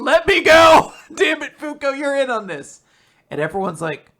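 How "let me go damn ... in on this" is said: high, loud mock scream; Latla screaming. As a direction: yell it as an outraged captive, hammed-up. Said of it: "As Latla is screaming".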